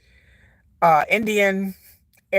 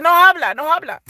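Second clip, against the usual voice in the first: high-pitched, louder mimicked protest; imitating daughter's refusal; 7 semitones higher, 5 dB louder than usual. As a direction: mimic a kid waving off Spanish. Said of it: "She said".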